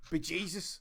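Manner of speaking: mock Irish accent. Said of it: "trying to be Irish".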